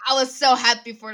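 loud, high-pitched lament; exasperated outcry. Real, not acted. Happiness spoiled by a teammate's reminder, exasperated outcry. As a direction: wail it out as a protest. Same outburst, joy spoiled.

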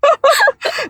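short high giggle; a quick high burst of giggling. Real, not acted. Guest giggling at her own joke about opera troupes.

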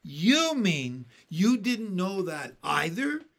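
centipede's mocking, incredulous question; scornfully. A narrator's reading. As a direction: sneer in drawn-out disbelief. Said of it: "said scornfully".

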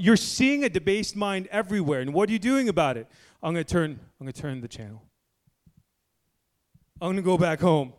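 loud, high-pitched sermon climax; fiery preaching. Acted. Preacher warning parents about their kids' schools, loud fiery preaching. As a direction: build it up into an alarmed warning.

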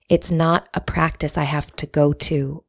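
ordinary talk

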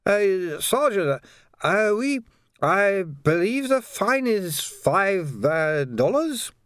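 put-on high, halting, slightly drunk voice; tipsy, dread-filled man. A narrator's reading. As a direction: offer it slowly and nervously, pitched well up. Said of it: "slightly the worse for drink".

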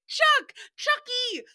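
high, slow sing-song calling for a smile; high playful photographer voice; cutesy photo coaxing. Pretend. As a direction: coax him to say cheese for the camera. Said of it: "taking a picture of him and you're like".

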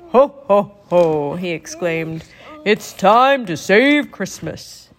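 put-on a warm, jolly old Santa, fuller in tone. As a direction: speak as a kindly Santa Claus.

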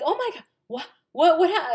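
high, loud, stammering shock; imitated shocked mom. Mom reacting to daughter's news, shocked and high. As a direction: stumble over it as a floored mother. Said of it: "screaming at the top of her lungs".

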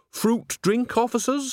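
very high, sing-out offer; fake-innocent server. A narrator's reading. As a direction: pipe up like a harmless serving woman. Fake-innocent witch offers spiked drink.

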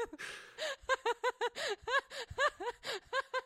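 high squealing laugh fit; shrill screeching laugh. Real, not acted. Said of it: "screeching instead of laughing".

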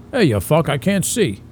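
mock tough Italian New York accent, gruff; tough Italian guy. A comic mimicking a tough blind Italian guy, mild Italian accent. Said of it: "a tough Italian guy that's blind".

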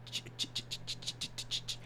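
soft, voiceless stuck "ch" repeats; stuttering like a sprinkler. Real, not acted. Podcaster stuck mid-question on podcast, sprinkler-like stuttering. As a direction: stutter on a quiet ch, ticking like a sprinkler. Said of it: "sound like one of the sprinklers".

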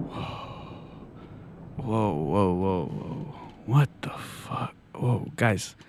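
low, hushed, groggy murmur; stunned near-whisper after a shock; dazed. Acted. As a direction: come to slowly, barely voicing the words. Host comes round after lightning strike.

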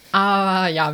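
ordinary talk, nothing marked

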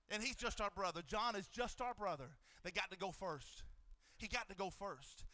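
gently remember a brother gone first; quiet, tender aside. Real, not acted. Tender drop about brother John.

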